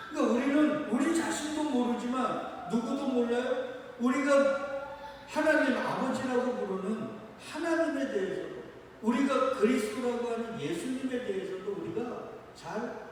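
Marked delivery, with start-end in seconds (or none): none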